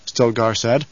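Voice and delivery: usual speaking voice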